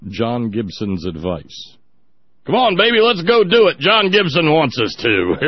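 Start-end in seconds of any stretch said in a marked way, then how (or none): 2.5-5.4 high, loud, eager mock lover's voice; mock amorous couple. A host mocks Gibson's listeners rushing to bed, in a high, loud, eager voice. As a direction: ham up a randy partner begging to go.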